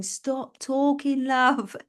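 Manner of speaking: body telling her to stop. Pretend. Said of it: "it was my body going".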